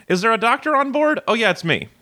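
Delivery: hammed-up doctor-pilot bit, the call for a doctor pitched high; quick goofy impression, swooping up high for the announcement; Brian. Host mimicking her doctor-pilot brother-in-law, quick joke impression. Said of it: "here's my quick impression of".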